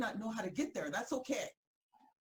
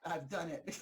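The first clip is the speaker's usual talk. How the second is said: normal talking voice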